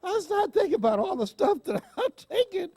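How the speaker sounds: words breaking into laughter, rising high; laughing through it; helpless laughter while telling it. Real, not acted. Overcome with laughter at her pills.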